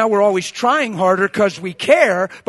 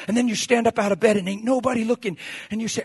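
ordinary delivery

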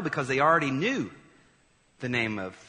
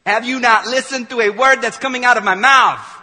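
exasperated Jesus; 10 semitones higher, 15 dB louder than usual. Pretend. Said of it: "if I was Jesus, I'd be like".

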